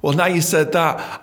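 ordinary talk, nothing marked

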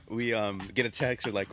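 ordinary talk

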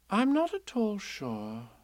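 slow, musing motherly voice; thoughtful. A narrator's reading. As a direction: ponder it aloud slowly. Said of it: "said Kanga in a thoughtful voice".

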